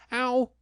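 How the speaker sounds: high yelp landing. A narrator's reading. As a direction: yelp as he comes down. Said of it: "he said".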